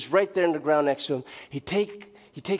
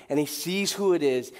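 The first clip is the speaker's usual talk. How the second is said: normal talking voice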